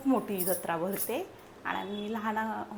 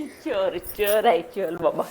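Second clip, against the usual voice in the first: louder playful teasing; 10 dB louder, slower than usual. Real, not acted. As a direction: tease the little one fondly. Teasing the baby.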